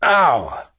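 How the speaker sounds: high, falling "ow" of pain; acted yelp after a hard impact; cry of pain. A narrator's reading. Downed Marine hits the wall, pained yelp. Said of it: "a grunt of pain".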